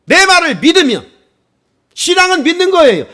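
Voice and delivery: loud, high preaching push; fervent preaching. Acted. Pastor urging the congregation to believe, fervent loud preaching. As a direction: drive it home to the congregation.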